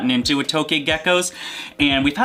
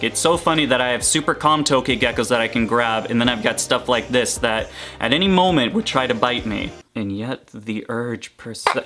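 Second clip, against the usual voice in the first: ordinary delivery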